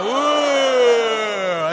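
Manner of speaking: high, loud drawn-out "Ooh"; loud awed ooh. Shown as a demonstration. Urging the audience to point at the star, awed. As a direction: gasp in wonder at the star.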